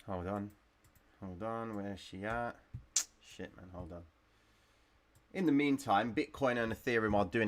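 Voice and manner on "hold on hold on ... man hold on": talking while vaping; words round a vape hit; vape in mouth. Real, not acted. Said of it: "Excuse the vape".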